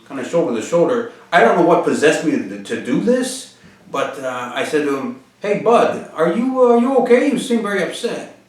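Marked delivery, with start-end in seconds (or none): 5.4-8.4 higher-pitched, gently checking on a stranger; retold concerned question, pitched up. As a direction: reach out to someone upset, voice lifted. Checking on upset stranger on street.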